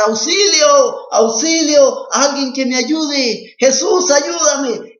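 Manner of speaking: high, loud panicked shouts; desperate cry for help. Acted. Young man hanging from a cliff screams for help, panicked. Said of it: "empieza a gritar".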